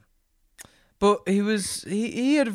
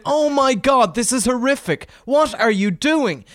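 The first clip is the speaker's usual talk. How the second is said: mock horrified passenger; 7 semitones higher, 3 dB louder than usual. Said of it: "who said".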